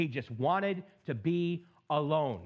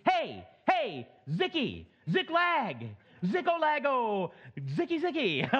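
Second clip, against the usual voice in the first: high, playful sing-song calling of a nickname; higher teasing chant of Zicky and Ziklag; nagging inner voice; 6 semitones higher, slower than usual. Pretend. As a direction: tease the name like a voice in your head. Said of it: "you hear my voice going".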